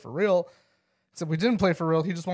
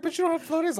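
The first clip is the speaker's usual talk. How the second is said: put-on high-pitched kid objection; imitated arguing child; 12 semitones higher, faster than usual. Kid challenges dad about floaties, high mock-child voice. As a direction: counter like an earnest little boy, much higher.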